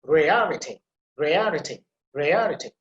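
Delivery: "Rarity" with a strong RA, then falling away; stress on first syllable. Shown as a demonstration. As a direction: punch the start of each "rarity". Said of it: "put emphasis on the first syllable".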